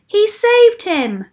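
loud, high, stretched-out call; eager shout. A narrator's reading. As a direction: shout it like an eager listener in a crowd. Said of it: "shouted another".

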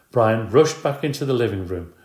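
usual speaking voice

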